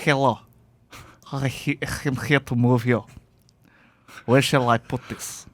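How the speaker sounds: put-on light Russian accent, stiff and formal. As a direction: imitate a polite Russian mover. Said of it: "What did they sound like in their Russian accents?".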